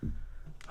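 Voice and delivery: long audible exhale; sigh. Real, not acted. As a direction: let out a breathy sigh. Pause after mother's refrain.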